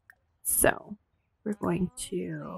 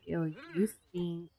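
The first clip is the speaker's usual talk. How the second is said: quiet disgusted; 8 dB quieter than usual. Retold or imitated. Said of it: "They're like".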